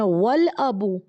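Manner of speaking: ordinary delivery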